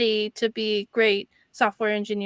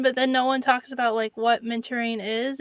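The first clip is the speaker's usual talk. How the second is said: ordinary talk, nothing marked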